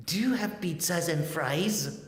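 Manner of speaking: put-on high, loud, slow child's question; high-pitched eager little kid. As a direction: pipe up like a kid ordering. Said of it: "that little child said".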